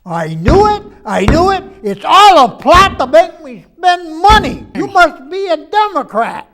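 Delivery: an owner catching his computer red-handed, indignant; triumphant outburst. Acted. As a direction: crow that you saw it coming.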